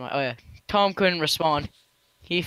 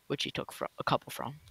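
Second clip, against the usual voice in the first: ordinary delivery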